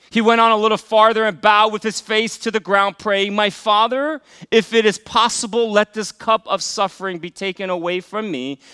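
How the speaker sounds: loud, high scripture reading from the pulpit; preaching at full force. Acted. Pastor reading Gethsemane to the church, in full preaching voice. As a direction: proclaim the passage to the congregation.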